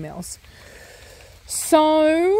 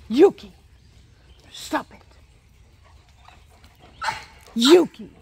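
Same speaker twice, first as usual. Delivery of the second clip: scolding the dog; 3 semitones higher than usual. Real, not acted. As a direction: snap the dog's name to stop her.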